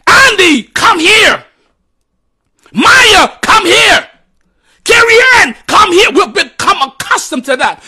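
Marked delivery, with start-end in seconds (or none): 0.1-6.1 high, loud mock hollering of each child; a string of names shouted across the house; parent shouting names. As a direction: yell the kids' names like a parent. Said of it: "We have come accustomed to hearing".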